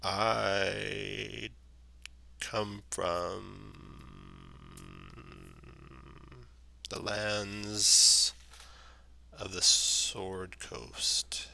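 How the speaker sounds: slow, whispery treant; talking tree. Acted. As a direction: drag each word like an ancient tree. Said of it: "speaks in a slow, slow voice".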